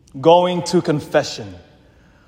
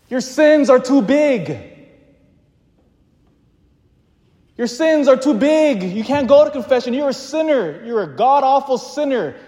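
accusing inner voice; 9 semitones higher than usual. Acted. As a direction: play the accuser shaming a sinner, pitched well up. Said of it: "Then you also hear".

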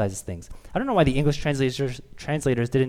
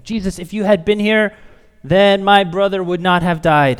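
raised, higher-pitched plea; sisters' reproach; 6 semitones higher, 9 dB louder than usual. Acted. Grieving sisters tell Jesus their brother would have lived, loud reproach. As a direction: cry it out loudly, pressing each word.

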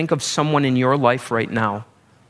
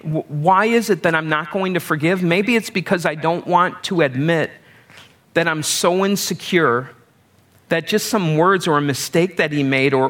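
ordinary delivery

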